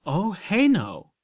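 high, loud, slow backpedaling cry; near shout. A narrator's reading. Applejack backpedaling in front of her friends, a near shout. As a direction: nearly shout it while backing off. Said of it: "Applejack nearly shouted".

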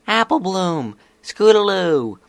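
high, loud shout of names; excited calling out. Acted. Sweetie Belle bursting in on her friends, calling out.

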